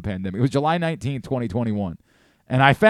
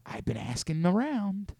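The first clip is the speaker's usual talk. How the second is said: soft, higher-pitched storyteller impression; Brian Windhorst; 5 semitones higher, 5 dB quieter than usual. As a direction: build a hushed mystery in a put-on high voice. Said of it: "Brian Windhorst right now".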